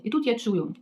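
ordinary talk

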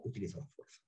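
breathy whisper, almost unvoiced; hushed guilty aside; whispered confession. Real, not acted. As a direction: let it slip out under the breath. Admits using force.

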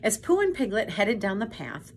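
ordinary delivery, nothing marked

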